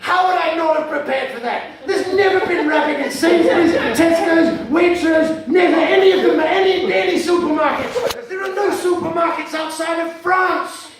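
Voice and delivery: loud, high comic outrage; hammed-up exasperation, pitched high and loud; indignant rant. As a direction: defend your research, hugely affronted. Outraged rant at audience challenge.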